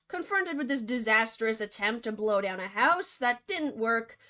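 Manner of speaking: ordinary delivery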